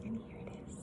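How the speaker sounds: a hushed, breathy reveal; whisper. Real, not acted. Teacher whispering to her third graders as she unveils the book. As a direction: whisper it like a secret.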